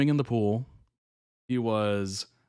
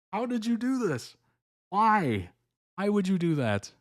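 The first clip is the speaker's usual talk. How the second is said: high exasperated disbelief; 9 semitones higher than usual. Retold or imitated. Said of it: "you're like".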